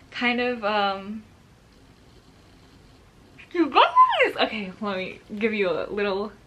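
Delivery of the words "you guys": high, loud squeal to the viewers; real delighted squeal; excited squeal. Real, not acted. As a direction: call out, thrilled.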